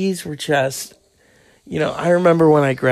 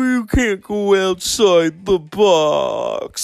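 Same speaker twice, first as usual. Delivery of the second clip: high, slow sing-song mockery of narrow-minded people; 7 semitones higher, slower than usual. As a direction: sneer it out in a high, put-on whiny voice. Mocking people's stereotype expectations.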